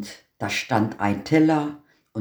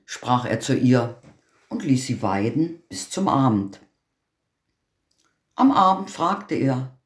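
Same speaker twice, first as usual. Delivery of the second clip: ordinary delivery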